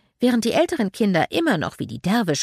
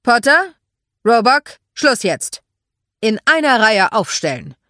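loud drill-sergeant commands; thundering orders; 4 semitones higher, 10 dB louder than usual. A narrator's reading. Man calling squabbling boys into line, thundering orders. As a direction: thunder each order at the unruly troop. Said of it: "donnerte eine Stimme".

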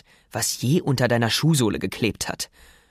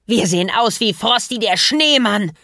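high shrieking villain, much louder; 8 semitones higher, 4 dB louder than usual. Acted. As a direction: shriek in outrage. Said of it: "kreischte er".

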